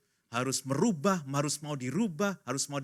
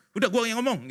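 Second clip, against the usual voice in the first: higher, louder cutting-in voice; impatient takeover; 10 semitones higher, 6 dB louder than usual. Acted. Spouse taking over when papa talks, impatient.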